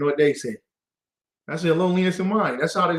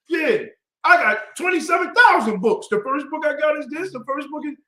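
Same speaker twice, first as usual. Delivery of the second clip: high, pushy one-upping voice; mock braggart; 10 semitones higher than usual. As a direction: brag over the other man. Mocks a one-upping brother.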